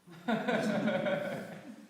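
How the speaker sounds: high rising amused laugh; god's laugh. Acted. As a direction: laugh it off, unbothered. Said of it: "And Lord Shiva just laughs".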